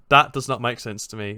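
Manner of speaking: normal talking voice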